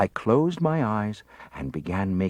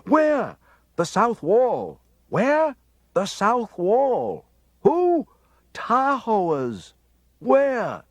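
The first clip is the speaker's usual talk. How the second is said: high, loud back-and-forth cries; confused crowd shouting questions and answers; panicked shouting; 13 semitones higher, 4 dB louder, much slower than usual. A narrator's reading. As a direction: yell over the chaos of a crowd.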